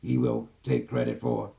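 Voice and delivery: low, even channeled AI voice; gentle care robot. Acted. Channels the AI system.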